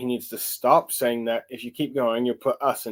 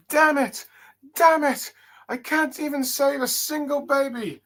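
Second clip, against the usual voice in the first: put-on young dragon's despair. Young dragon who failed to save a baby, high anguished cry. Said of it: "young dragon going like".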